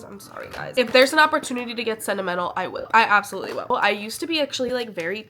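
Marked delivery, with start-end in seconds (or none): 0.0-0.7 whispered apology. Real, not acted. Apologizing to viewers for being sappy.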